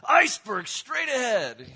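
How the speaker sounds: high, loud, drawn-out shout; yelled warning. Retold or imitated. Preacher mimicking a yell at a Titanic screening, yelled warning. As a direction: cry it out like a ship's lookout. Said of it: "I wanted to stand up and yell".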